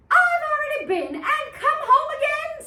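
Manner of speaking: high, fast pig voice; a cheeky little pig pleased with himself. Acted. Pig tells the wolf he's already been, high and fast.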